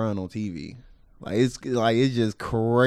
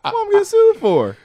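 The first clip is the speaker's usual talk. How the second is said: surprised; 5 dB louder than usual. Real, not acted. Shocked high question.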